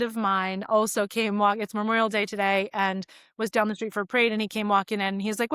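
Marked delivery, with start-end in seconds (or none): none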